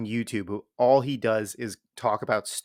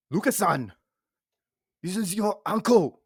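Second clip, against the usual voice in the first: higher put-on Japanese accent; like Arnold Schwarzenegger doing Japanese; 8 semitones higher than usual. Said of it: "doing a Japanese accent".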